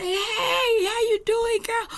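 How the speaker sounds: put-on high, gushing hello to an old friend; excited greeting. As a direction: squeal it out like you just spotted her across the street. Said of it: "somebody riding by and say".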